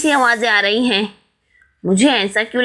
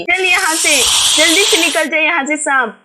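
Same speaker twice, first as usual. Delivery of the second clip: shooing shout; 5 semitones higher than usual. Acted. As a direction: drive the snake out. Shooing the snake away.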